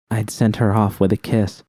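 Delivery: usual speaking voice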